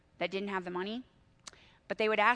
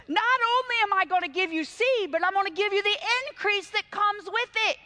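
high, loud rising proclamation; preaching at full force; 8 semitones higher, 5 dB louder than usual. Acted. Preacher promising the congregation increase, at full force. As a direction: preach it out to the whole room.

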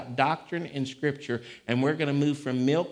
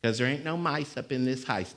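ordinary talk

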